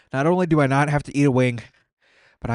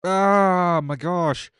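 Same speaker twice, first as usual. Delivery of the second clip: long slow pained moan; drawn-out groan; 4 semitones higher, 3 dB louder, much slower than usual. Real, not acted. Suffering through hot wings, a drawn-out groan. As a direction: stretch it out like the heat won't quit.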